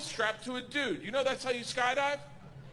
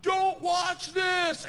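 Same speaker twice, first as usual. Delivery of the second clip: high, slow, drawn-out mock yell; shouted warning; 8 semitones higher, 4 dB louder, much slower than usual. She warns him before jumping out, imitated drawn-out yell.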